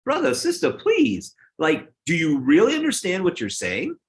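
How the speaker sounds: very high-pitched, incredulous appeal; playful, high-pitched disbelief; mock exasperated plea. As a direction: plead with them to hear themselves. Acted retort to admiring comments.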